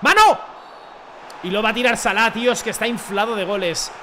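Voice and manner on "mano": sudden high yell for a handball; real outburst at the referee; shout. Real, not acted. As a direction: shout the appeal at the screen. Appealing for handball in the game.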